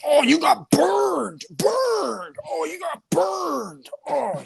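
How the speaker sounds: hammed-up burned taunt; mock Kelso yelling. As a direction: howl it like a gleeful tease. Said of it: "Kelso just goes around going, fucking yelling".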